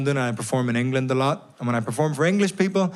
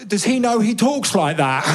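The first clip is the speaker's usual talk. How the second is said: higher-pitched, stressed English onlooker; mock-stressed audience voice; stressed English audience member; 7 semitones higher than usual. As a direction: imitate a baffled English spectator, pitched up. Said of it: "English people in the audience get really stressed".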